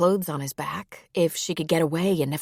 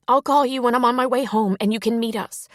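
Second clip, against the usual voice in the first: higher-pitched, earnest phone voice; emotional Sierra; 7 semitones higher, 5 dB louder than usual. A narrator's reading. Sierra phones Connie to say she has Sam, emotional. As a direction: lift the pitch and break the news.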